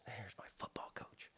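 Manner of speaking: hushed, confiding aside; whisper. Real, not acted. Introducing his first coach to the host, whispered. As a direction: drop to a whisper as if letting them in on it.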